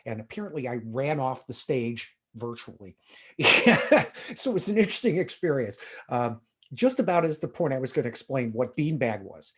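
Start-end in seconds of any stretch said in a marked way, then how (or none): 3.4-4.3 short high, loud laugh. Real, not acted. Speaker amused at running off the virtual stage, laughing. 4.4-5.8 higher, louder, through real laughter; laughing. Real, not acted. Speaker to audience about the stage mishap, laughing.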